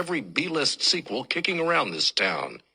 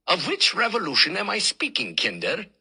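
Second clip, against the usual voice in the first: lightly voiced old German professor with a thick German accent; a hint of a pompous German lecturer, German accent; 4 semitones higher than usual. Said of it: "speaks in a German accent so thick".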